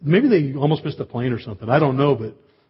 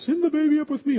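wife's high voice. Retold or imitated. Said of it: "his wife goes".

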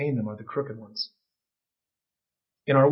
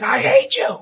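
shrieked mock "I hate you", much higher and louder; hammed-up furious cry; angry Anakin; 8 dB louder, slower than usual. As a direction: scream it like a betrayed son. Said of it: "he looks up with these angry red eyes, and he says".

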